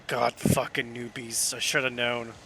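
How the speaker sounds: low, soft disgusted grumble; quiet, low-pitched griping; grumbling to himself. Acted. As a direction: grumble it under your breath. Grumbling to himself after yelling.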